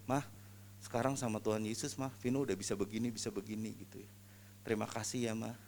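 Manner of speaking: soft, hushed, speaking to his dying mother; gently, barely above a breath; tender quiet words to mother. Retold or imitated. Son to his dying mother, hushed and tender.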